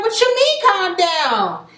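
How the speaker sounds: high, indignant snap back; mock angry. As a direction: play her offended and rising. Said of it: "she getting mad now".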